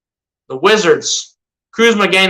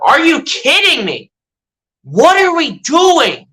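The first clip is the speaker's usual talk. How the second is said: higher, drawn-out disbelief at the team; exasperated disbelief; 7 semitones higher, slower than usual. Real, not acted. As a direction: throw up your hands at a missed shot. Team misses free throw.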